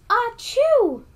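hammed-up high loud sneeze; big sneeze. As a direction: let rip a huge cartoon achoo. Bear sneezes in the story.

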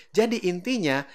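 ordinary talk

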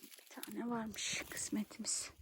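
soft unvoiced whisper. Real, not acted. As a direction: keep it to a whisper. Whispering while foraging.

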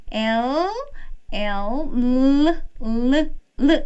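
L with tongue tip behind teeth; L sound demo. Shown as a demonstration. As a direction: hold the L with tongue on the ridge. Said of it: "이런 소리가 납니다".

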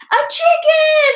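very high, astonished shout. A narrator's reading. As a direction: shout it out in amazement. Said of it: "they all shouted".

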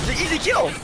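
high, loud exasperated cry; exasperated yell. Real, not acted. Player urging teammate toward an easy kill, exasperated yell. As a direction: shout it in disbelief at your teammate.

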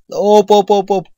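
loud, high, rapid-fire interjections; alarmed hops. Real, not acted. Player dodging in the game, loud alarmed calls.

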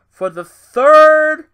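incredulous shout. Real, not acted. Disbelief at Sasha's title win.

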